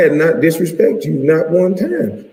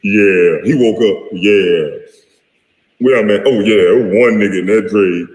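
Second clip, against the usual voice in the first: imitating Jack; 7 semitones lower than usual. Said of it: "And then Jack said".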